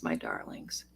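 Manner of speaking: soft tender whisper. Real, not acted. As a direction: breathe the words gently to them. Tender address to viewers, whispered.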